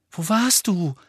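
worried. A narrator's reading. Hermine asks Ron where he was, worried. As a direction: blurt it out with concern. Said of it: "fragte Hermine besorgt".

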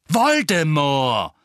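loud, drawn-out angry outburst. A narrator's reading. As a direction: burst out with it, furious and stretched. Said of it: "sagte Harry zornig". (zorniger, lauter Ausbruch)

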